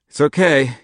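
a lightly voiced tired father; soothing his daughter through a headache; gentle, hurting dad; father's reassurance. A narrator's reading.

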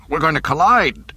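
loud, high panicked report. A narrator's reading. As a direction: cry out the warning in alarm. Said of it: "the panic tracking officer reported".

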